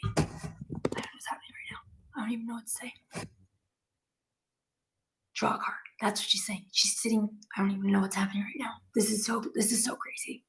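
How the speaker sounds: very soft, hushed disbelief; stunned murmur. Real, not acted. Medium overwhelmed by spirit sitting beside her, hushed and stunned. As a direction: say it under your breath, overwhelmed.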